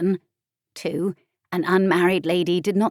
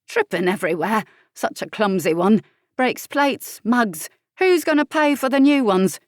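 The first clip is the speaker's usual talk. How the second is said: scolding grandmother; 4 semitones higher, 5 dB louder than usual. Acted. Grandmother scolding about the child.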